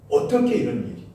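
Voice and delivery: high, loud cry of amazement; astonished cry. Retold or imitated. Preacher voicing the stunned crowd with a high astonished cry. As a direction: gasp it out as if seeing a miracle. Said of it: "너무너무 놀란 거예요".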